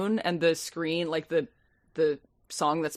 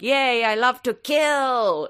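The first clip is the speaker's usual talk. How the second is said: gleeful killer voice; 5 semitones higher, much slower than usual. Retold or imitated. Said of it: "he's like".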